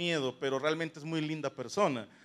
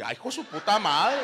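a laughing, louder shocked curse; 3 semitones higher, 5 dB louder than usual. Retold or imitated. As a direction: react stunned and amused. Shocked reaction to homemade weapon.

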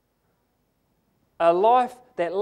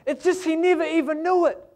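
ordinary delivery

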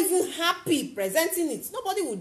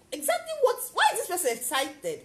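curious surprise; 6 semitones higher than usual. Retold or imitated. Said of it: "they'll be like".

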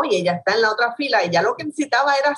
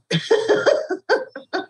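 amused high laugh; a real amused high laugh; 11 semitones higher than usual. Real, not acted. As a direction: laugh at how it ended. Laughs at end of story.

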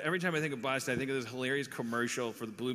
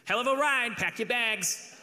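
put-on high, punchy Boston-accented ad testimonial; Boston testimonial guy; 9 semitones higher than usual. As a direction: sell it like a Boston everyman in a commercial. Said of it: "doing one of those testimonials from Boston".

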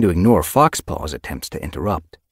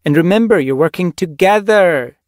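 high, loud mentor's reminder; giving directions; 13 semitones higher, 10 dB louder than usual. A narrator's reading. Mentor reminds two apprentices to hunt as a team, directing. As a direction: drive the order home, louder. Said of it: "she directed".

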